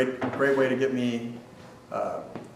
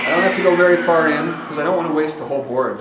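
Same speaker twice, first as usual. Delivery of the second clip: shouting over table saw; 5 semitones higher, 9 dB louder than usual. Real, not acted. Talking over running table saw.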